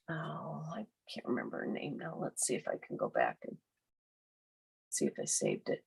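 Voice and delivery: whispering to herself while searching. Real, not acted. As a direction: drop to a whisper, thinking aloud.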